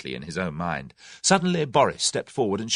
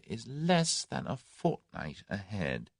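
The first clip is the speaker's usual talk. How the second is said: softer and slow, musing; thoughtfully; 8 dB quieter, slower than usual. A narrator's reading. Tommy thoughtfully hinting at the date, slow and soft. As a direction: let it hang, slow and soft. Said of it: "he said thoughtfully".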